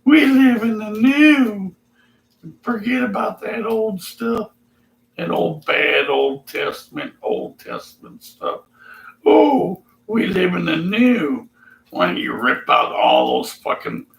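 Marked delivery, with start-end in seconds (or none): 0.0-1.7 high, loud, slow put-on voice. Imagined believer proclaims the new, high and loud. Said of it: "he's gonna say". 2.6-9.8 high put-on dismissive voice. Imagined believer brushing off the old stuff, high and smug. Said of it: "he's gonna say". 10.1-11.5 higher put-on voice. Imagined believer repeats his line, high and smug. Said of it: "he's gonna say".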